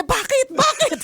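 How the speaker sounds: high, urgent repeated why; panicked husband. Retold or imitated. Husband rushing over in panic, high alarmed why. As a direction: imitate his panic, pitched far up. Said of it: "yung taranta niya".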